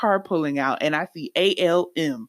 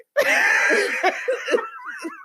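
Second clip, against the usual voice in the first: very high shrieking laugh. Real, not acted. Hearing the car sign mix-up, a real high shrieking laugh. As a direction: lose it completely. Co-host cracks up.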